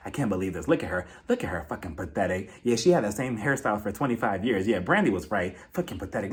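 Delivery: low, hushed griping under the voice; mock whispered bad-mouthing to friends; imitated whispering. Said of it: "she's whispering to Garcelle and Crystal".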